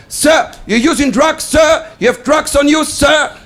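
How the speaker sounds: high, forceful accusing officer; hammed-up interrogating customs officer. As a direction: shout the accusation, hitting each "sir". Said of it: "two customs officers, they took me aside".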